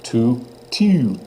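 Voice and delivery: "Tu, tu" with rounded, fronted vowel; French tu. Shown as a demonstration. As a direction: repeat French "Tu" with tight lips. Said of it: "If you can hear the difference there".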